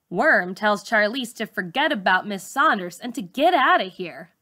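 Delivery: ordinary delivery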